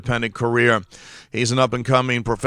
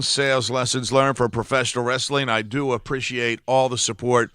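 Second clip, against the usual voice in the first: usual speaking voice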